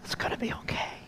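hushed, unvoiced-breath whisper into an ear; very soft soothing whisper, with a long pause between; comforting whisper. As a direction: whisper it softly, as reassurance to a dying dog. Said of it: "I just was whispering in her ear".

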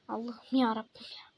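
soft, unvoiced groan to God; despairing whisper. Real, not acted. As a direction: whisper it like you've given up. Despair after dying.

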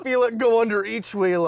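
ordinary delivery

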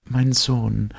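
high, tender heroine voice; a gentle, dreamy young woman; young woman's voice. Acted. Elita voiced by narrator. (hohe, zarte Frauenstimme)

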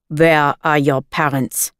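a hint of a stern guard; soldier demanding. A narrator's reading. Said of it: "he demanded".

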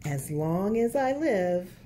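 put-on lilting picture-book narration for kids; reading to children. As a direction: read it like storytime at the library.

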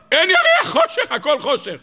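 very high-pitched cry of alarm; dramatic outcry. Acted. Preacher showing his congregation the moon has gone, dramatic outcry. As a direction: cry it out high, then come down.

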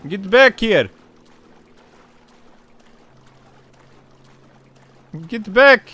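loud call after someone; shouting. Pretend. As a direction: yell at them to come back. Yelling after a driver.

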